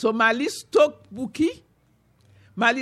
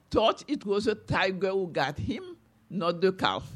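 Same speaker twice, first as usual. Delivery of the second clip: plain reading voice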